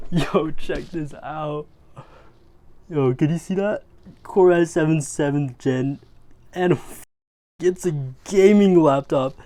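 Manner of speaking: loud giddy excitement; thrilled, laughing through the specs; geeking out. Real, not acted. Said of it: "I'm geeking over these laptops".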